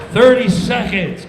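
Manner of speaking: loud call; countdown call. Retold or imitated. Comic imitates stage manager countdown, loud. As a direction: shout the countdown.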